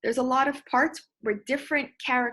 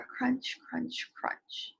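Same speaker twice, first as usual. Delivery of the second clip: whispered, fading softer; spooky whisper; 8 dB quieter, much slower than usual. Shown as a demonstration. Teacher recalling a crunch in the dark, whispered. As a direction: hush it like a sound in the dark. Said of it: "make my voice sound like what's happening".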